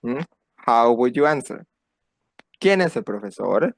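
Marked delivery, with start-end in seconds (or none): 2.6-3.7 "profesor" lifts at the end; rising question intonation. Shown as a demonstration. Teacher models a Spanish question for learners, rising intonation. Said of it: "that intonation goes up at the end".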